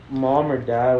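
ordinary delivery

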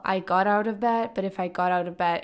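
ordinary talk

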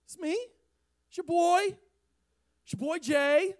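chummy high-pitched reassurance; Jesus as buddy. Acted. Jesus calming scared friends on the water, chummy. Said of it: "So Jesus is like".

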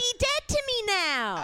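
high, slow mock-dramatic. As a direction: declare it like a diva. Dramatic grudge declaration.

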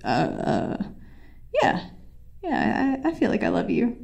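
hesitant, halting imitation of a boyfriend; mock-reluctant declaration with long pauses; reluctant agreement. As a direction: stall on soft uhs, then give in. Boyfriend pressured into saying it.

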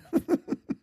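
short, high-pitched real laugh; high burst of laughter. Real, not acted. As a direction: laugh up high, quick and quiet. Laughs after bat story.

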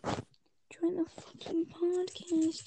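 slow hushed whisper under breath. Real, not acted. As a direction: whisper it to yourself, drawn out. Muttering while struggling with link.

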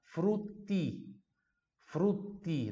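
frutti with a crisp pure T, not tchi. Shown as a demonstration. Teacher warning the students, pure T in frutti. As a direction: hit the tt in frutti clean, no tch. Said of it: "Pronúncia pura".

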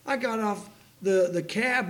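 ordinary delivery

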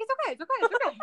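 high, repeated frantic reassurance; mock panic. Viewer telling herself it's okay, comic panic. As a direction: comfort yourself in hammed-up panic. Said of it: "I'm, like, having a panic attack".

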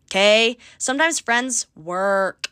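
normal talking voice

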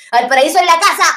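loud proud shout-out, rising at the end; a rising cheer, like a DJ shouting out the hometown crowd. Real, not acted. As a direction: hype up the crowd for Valparaíso.

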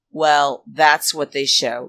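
usual speaking voice

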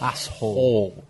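Asshole with a clear H sound. Shown as a demonstration. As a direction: slowly say Asshole with H. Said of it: "We pronounce H's in English".

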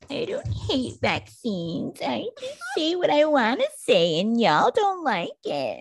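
high, exaggerated dismissive voice; mocking Joe Rogan. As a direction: mimic him as smug and whiny. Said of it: "Joe Rogan's response is basically like".

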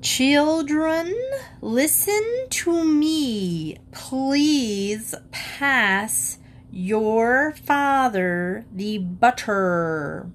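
high, very slow and drawn out; slow, lilting high over-enunciation; mother coaxing the children, high and slow. A narrator's reading. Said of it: "in a high, natural voice".

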